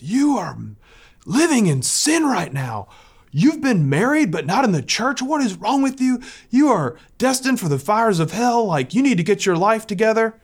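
high, scolding mock rant; retold stranger berating the caller about hell; giving her the business. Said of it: "giving her the business".

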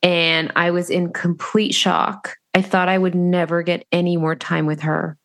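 ordinary delivery

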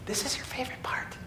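put-on teasing whisper repeating the boy's words; mock whisper. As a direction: whisper it like a ridiculous secret.

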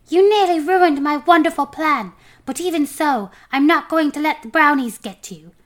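high, loud indignant voice; scolding homunculus. A narrator's reading. Homunculus berating the dwarf over his plan, indignant.

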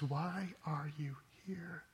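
slow, breathy whisper of God; a still small voice, whispered. A narrator's reading. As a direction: barely breathe the question. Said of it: "a whisper comes back".

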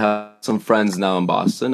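usual speaking voice

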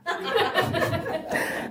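sudden high laugh at himself; high burst of laughter. Real, not acted. Laughs at own story.

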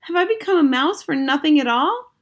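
put-on high, loud despairing boy; loud high protest; cried out. A narrator's reading. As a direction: plead it, all that work wasted. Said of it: "I cried".

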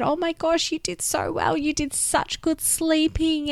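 put-on gentle sing-song praise for a child; praising her toddler. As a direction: coo the praise to a little one.

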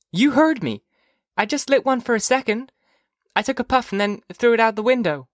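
high little-sister voice; young girl Phoebe. Acted. Phoebe admitting a puff to her mother, high girlish voice. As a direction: confess in a high child's voice.

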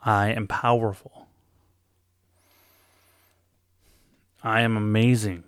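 building from plain to loud; swelling affirmations, ending loud. Shown as a demonstration. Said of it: "Getting louder each time".

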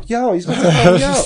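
high and loud, retelling alarm as the bus blocks the truck; a sudden worried shout-out; alarmed call. Retold or imitated.

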